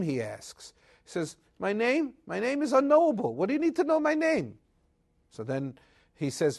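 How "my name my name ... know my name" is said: high, quick, lightly voiced angel; evasive angel. Angel dodging the husband's question, high and quick. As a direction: brush the question off airily.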